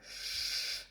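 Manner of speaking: sh hissed long and strong; a hard drawn-out sh. Shown as a demonstration. Teacher showing the Russian Ш. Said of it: "and very stronger, like sh".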